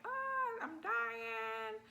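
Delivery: very high hammed-up wail; mocking her own overreaction in labour, shrill and hammed. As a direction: overplay the agony. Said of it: "freaking out with the pain".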